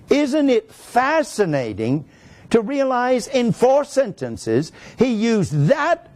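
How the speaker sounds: high, drawn-out wonder on fascinating; marvelling. Real, not acted. Teacher marvels to the class at the grammar, marvelling. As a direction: savour it slowly, pitched high with amazement.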